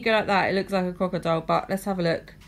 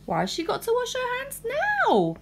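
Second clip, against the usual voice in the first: cartoon princess; 13 semitones higher, faster than usual. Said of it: "princess protest, whiny".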